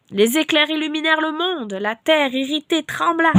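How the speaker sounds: loud, declaiming scripture reading; fervent psalm reading. Acted. A preacher reading Psalm 77 to listeners, fervent declaiming. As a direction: proclaim the psalm like a lament.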